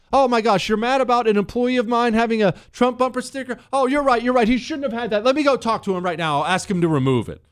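high-pitched, anxious mock employer; flustered appeasing. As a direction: cave instantly to an angry complaint. Acts employer caving to angry caller.

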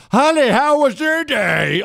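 very high and loud, slow, mocking a wife's greeting; wife mocked greeting her husband, shrill. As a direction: screech the greeting like a caricature. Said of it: "married to a voice like that".

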